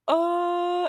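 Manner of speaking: high loud startled uh; shocked gasp. Retold or imitated. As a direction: recoil in fright. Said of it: "we're like".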